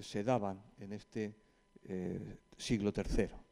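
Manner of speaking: ordinary delivery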